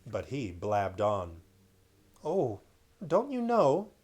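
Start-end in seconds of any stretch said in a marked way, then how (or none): 2.2-3.9 put-on surprised child.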